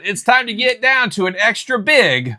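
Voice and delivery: high, sung-out show intro, slowing at the end; hyped segment announcer. Acted. Host launching the weekly purchases segment, hyped announcer. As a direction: build it up like a big reveal.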